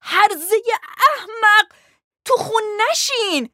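very high, loud angry scream; furious high-pitched yell. A narrator's reading. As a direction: shriek the insult in fury. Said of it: "فریاد زد".

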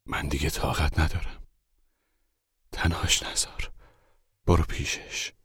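a father near tears, half whispered; tear-scraped voice. A narrator's reading.

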